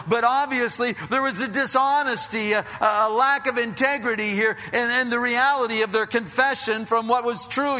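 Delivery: fervent sermon voice, pitched high and carried loud; preaching at full force. Real, not acted. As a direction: preach it out to the whole room. Preaching to the congregation.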